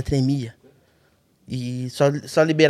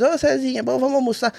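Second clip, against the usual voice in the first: chamado de amigo; 8 semitones higher than usual. Retold or imitated. Amigos chamando para almoçar.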